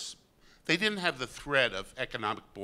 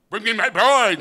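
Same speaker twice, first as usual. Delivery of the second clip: much higher, loud bellowing command; blustering general; 7 semitones higher, 11 dB louder than usual. Retold or imitated. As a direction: roar for the bride like a vain soldier. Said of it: "when Miles Glorious was".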